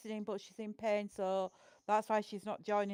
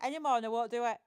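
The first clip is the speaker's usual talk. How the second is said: ordinary talk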